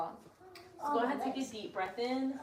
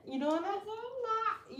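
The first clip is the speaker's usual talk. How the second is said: higher and very slow teasing; drawn-out teasing; 10 semitones higher, much slower than usual. Acted. Teasing the little girl about her yoga, drawn-out high. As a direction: stretch the question out to tease.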